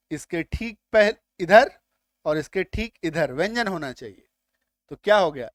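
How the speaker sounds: ordinary talk, nothing marked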